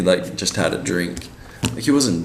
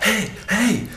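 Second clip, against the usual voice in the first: high-pitched calling out; imitated yell. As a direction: call out high to catch someone's attention. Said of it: "yells at him".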